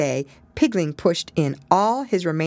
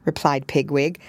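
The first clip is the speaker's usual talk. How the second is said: plain reading voice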